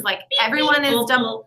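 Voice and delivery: ordinary talk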